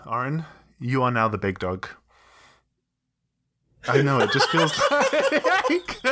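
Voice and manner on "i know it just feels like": high, slow words dissolving into giggles; breaking into laughter. Real, not acted. Former big dog laughing with relief. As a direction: laugh through the line helplessly.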